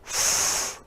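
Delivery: a long unvoiced "f" hiss through the teeth; voiceless f sound. Shown as a demonstration. Teacher showing children the "f" sound. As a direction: blow out a breathy "f" sound with no voice behind it. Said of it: "F says".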